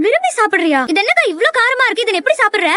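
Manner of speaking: high-pitched, disbelieving protest; aghast at the spice; incredulous. Acted. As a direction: complain with the mouth still burning. Incredulous about spicy snack.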